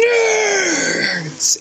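high shouted cheer. Acted. Echoing the topic shout.

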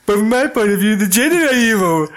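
high-pitched acted movie line; mock film villain. Acting out Obi-Wan and Anakin's film dialogue.

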